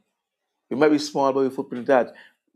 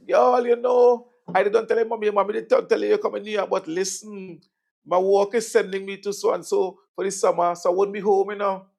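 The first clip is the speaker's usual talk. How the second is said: auntie voice; 9 semitones higher, 4 dB louder than usual. Retold or imitated. Auntie explains by phone.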